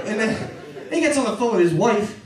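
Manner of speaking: usual speaking voice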